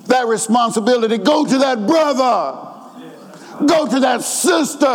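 high, ringing sermon exhortation; impassioned preaching. Real, not acted. Preaches at full force.

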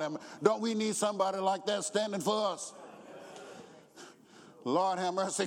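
normal talking voice

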